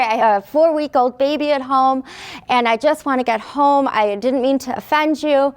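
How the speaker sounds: usual speaking voice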